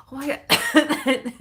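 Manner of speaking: slow, loud gasping Oh my God; burning lips gasp. Real, not acted. Spicy lip gloss burns, coughing.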